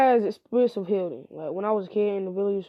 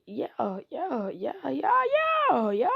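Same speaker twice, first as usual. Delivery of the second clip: drawn-out yeahs; 4 semitones higher, much slower than usual. Retold or imitated. Mock Kakashi brushing it off.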